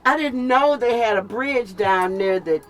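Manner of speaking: normal talking voice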